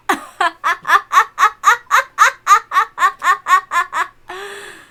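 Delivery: loud shrieking cackle. Acted. Laughing at paperweights.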